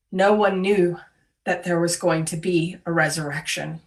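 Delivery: usual speaking voice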